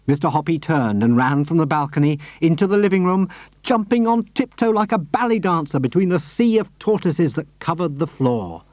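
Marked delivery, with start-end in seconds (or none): none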